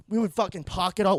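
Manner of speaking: ordinary delivery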